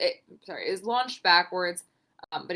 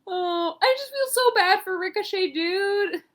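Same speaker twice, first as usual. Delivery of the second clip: pitched-up wail of sympathy; high pity; 11 semitones higher, 4 dB louder, slower than usual. Real, not acted. Podcaster wailing with pity for Ricochet. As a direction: moan high for the poor guy. Pitying the wrestler.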